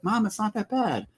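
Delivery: put-on high-pitched child's voice; mock kid voice. Husband imitating the kids reassuring mom, in a high kid voice. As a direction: squeak it like a kid being polite. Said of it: "the kids were even like".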